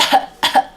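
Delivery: short breathy fake cough like the baby's; imitated fake cough. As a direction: fake a silly little cough. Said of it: "and she just fake coughs".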